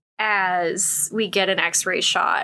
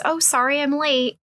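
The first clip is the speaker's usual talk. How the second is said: higher-pitched apologetic quote; mock apology; 6 semitones higher than usual. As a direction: voice her apology in a raised pitch. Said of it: "she's apologizing".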